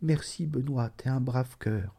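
exhausted mother quietly thanking Benoît; faint stammered thanks. A narrator's reading. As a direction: thank him weakly, barely holding on. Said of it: "Elle balbutiait".

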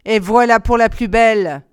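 a cruel jeering cackle of a line; sneering old woman. A narrator's reading. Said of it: "ricana la vieille".

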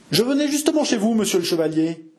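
high, fawning innkeeper voice; bowing and scraping innkeeper; innkeeper Landry. A narrator's reading. Innkeeper greets the chevalier, high and obsequious. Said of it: "dit Maître Landry".

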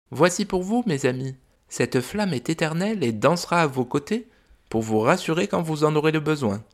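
high, gentle mother dragon voice; a fond, worried dragon mum. Acted. Mother dragon telling the visitors about her son, high gentle voice.

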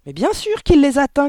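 high, loud, scornful cry; mother's shout. A narrator's reading. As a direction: shout him down in exasperation. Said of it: "cria la mère". (cri aigu et fort, exaspéré)

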